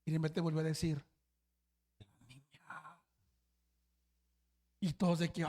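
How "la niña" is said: drawn-out toneless whisper, far softer; slow eerie whisper. Retold or imitated. Sleepwalker whispering again in the dark room. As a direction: barely breathe it, slow and spooky.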